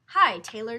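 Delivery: loud, booming hi; hearty greeting. A narrator's reading. Lion greeting the tailor on entering. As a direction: call out cheerfully.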